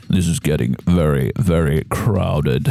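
low, menacing monster drawl; deep villain, slowing on the threat; shark villain voice. Acted. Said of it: "as he looks towards you, he says".

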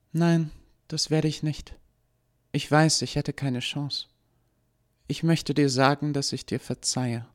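high, pitying calm voice; compassionate girl. Acted. Eva forgives Lucy.

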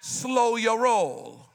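very slow, each word stretched; slow drawn warning. Shown as a demonstration. Pastor telling a young athlete to slow down. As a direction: stretch it out to make him slow down.

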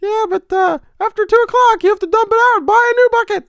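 high squeaky cartoon mouse; Mickey Mouse voice. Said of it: "my Mickey impression".

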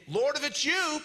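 high, projected call; mild yell. Acted. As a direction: call out eagerly across the water. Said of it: "literally yells out in verse twenty-eight".

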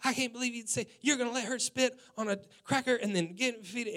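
high, indignant complaint; wife going off. Retold or imitated. Wife scolds husband over the cracker, high and indignant. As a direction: voice her outrage at the husband. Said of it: "she went off".

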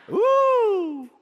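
high, sharp ooh of shock. Real, not acted. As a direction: let out a stunned ooh. Preacher reacts to shocking demand.

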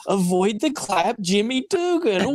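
high, slow, drawn-out call; acted movie quote. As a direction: sing it out high and stretched.

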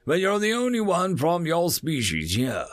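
a hint of a brisk soldier; lightly voiced sergeant. Sergeant Garrick voiced in story.

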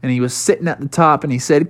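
ordinary delivery

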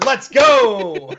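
high excited shout. Real, not acted. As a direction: cheer it out. Excited cheer.

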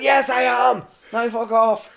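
high, loud defiant yell; shouted retort. Retold or imitated. Rescuer back down at climber, defiant shout. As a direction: yell back and send him packing.

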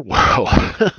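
laughs it off; quick amused laugh. Real, not acted. Laughing at own intro.

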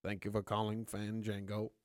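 a hint of a calm movie narrator; Morgan Freeman. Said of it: "this is Morgan Freeman".

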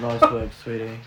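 campy sinister purr; camp villain voice. Retold or imitated. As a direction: lay the camp on thick. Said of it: "I made that sound more camp".

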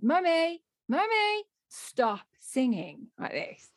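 high calls, then slow, solemn scolding; serious four-year-old. Retold or imitated. Daughter in car seat tells mum off, deadly serious. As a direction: scold like a very serious small child. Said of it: "the sort of seriousness that only at that point a four-year-old can pull".

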